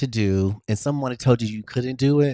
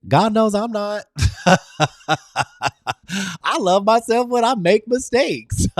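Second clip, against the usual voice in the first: higher and louder, laughing through it; 10 semitones higher, 4 dB louder than usual. Real, not acted. Admitting she makes mistakes to her viewers, laughing. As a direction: laugh at your own flaws.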